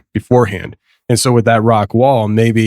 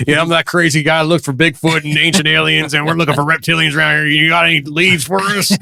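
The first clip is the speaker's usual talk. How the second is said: higher, teasing crazy-guy voice; self-mocking joke voice; 5 semitones higher than usual. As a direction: play up the weirdo who hunts Bigfoot. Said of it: "you know, I'm like".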